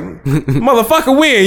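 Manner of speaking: high, drawn-out mock disbelief; mock incredulous challenge. Speaker replays arguing with his friend over Lil Brother, mock incredulous. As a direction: challenge him in amused, high-pitched disbelief.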